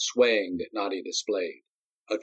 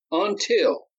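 raised, higher, quieting a crowd; commanding Until; 11 semitones higher, 4 dB louder than usual. A narrator's reading. Darius hushing the murmuring crowd, commanding. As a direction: call over the chatter. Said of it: "held up his free hand to quieten the chatter".

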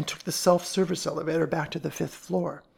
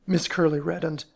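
plain reading voice

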